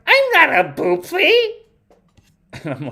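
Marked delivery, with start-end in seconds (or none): 0.1-1.3 put-on very high, loud child's protest; mock indignant little-kid voice; mad little girl. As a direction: squeak it like an indignant small kid. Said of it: "She's getting mad".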